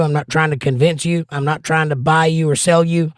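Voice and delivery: ordinary delivery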